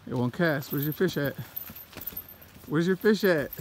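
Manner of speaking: ordinary talk, nothing marked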